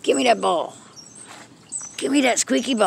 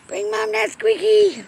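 same request jumped much higher; high coaxing; 10 semitones higher than usual. Real, not acted. Owner coaxing her cat to bring the toy, high coaxing voice. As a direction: coax the cat in a high sing-song.

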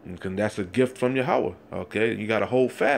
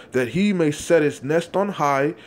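fiery scripture reading; 3 semitones higher, 3 dB louder than usual. Acted. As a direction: preach the verse with mounting force. Street preacher reads scripture aloud.

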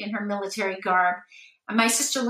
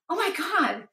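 freaked out; 7 semitones higher than usual. Retold or imitated. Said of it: "they freaked out".

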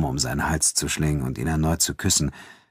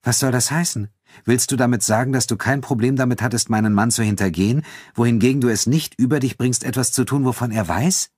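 higher-pitched, challenging female character; indignant questioning, pitched up to the end; Elena, indignant; 7 semitones higher than usual. Acted. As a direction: press him with an incredulous question.

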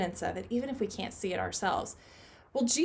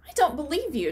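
higher, louder flat refusal; doubting protest; 5 semitones higher, 6 dB louder, faster than usual. Acted. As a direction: push back, not buying it. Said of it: "Thomas said".

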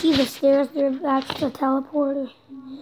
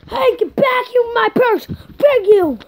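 raised, higher-pitched indignant call; 6 semitones higher, 5 dB louder than usual. Pretend. Calling after someone over a found purse, calling out. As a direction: play-act shouting after someone.

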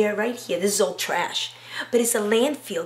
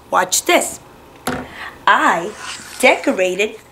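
put-on louder, lively voice for little children; bright kids' show teacher; 2 semitones higher, 11 dB louder than usual. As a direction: sell it to a room of kindergartners. Teacher addresses kids on camera.

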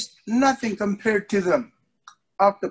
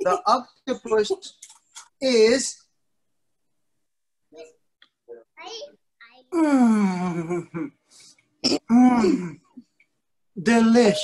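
drawn-out hums of pleasure before "Delish"; hammed-up yummy hums for a kids' audience; savoring delish; much slower than usual. Shown as a demonstration. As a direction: relish it like a tasty bite, then land the word. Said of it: "Okay, watch".

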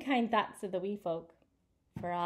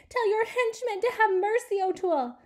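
begging; 11 semitones higher, 5 dB louder than usual. Acted. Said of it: "begged Magoon".

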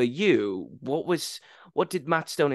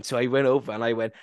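ordinary talk

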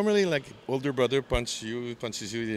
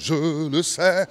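slow, slightly raised French croon; 5 semitones higher, 6 dB louder, much slower than usual. Retold or imitated. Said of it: "that's how [name] goes".

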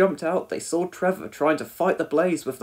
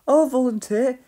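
high, eager and louder; hopeful pipe-up; 9 semitones higher, 5 dB louder than usual. A narrator's reading. Elvis offers to help at the first aid drill, hopefully piping up. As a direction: pipe up brightly to offer yourself. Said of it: "Elvis piped up hopefully".

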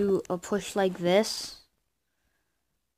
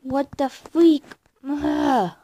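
frustrated outburst; 7 semitones higher, 5 dB louder, slower than usual. Real, not acted. Lag ruins the game.

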